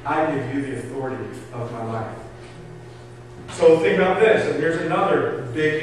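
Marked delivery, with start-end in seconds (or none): 0.1-2.2 low, soft, humble surrender; humble prayer. Acted. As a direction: pray humbly, dropping low and quiet. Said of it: "I'm humbly coming to you".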